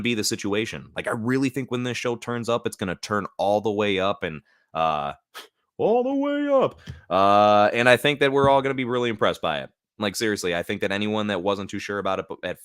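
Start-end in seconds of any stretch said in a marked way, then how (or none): 5.8-6.8 very high, drawn-out falsetto; silly high voice. Pretend. Streamer hyping the show, silly high falsetto. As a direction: squeal it slowly in a put-on voice. Echoes own phrase in silly voice.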